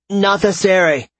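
loud, high spell invocation; shouted incantation. A narrator's reading. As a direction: cry out the magic words. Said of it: "Caden invoked the runes".

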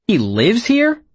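lightly voiced surprise; a startled, rising question; surprised.

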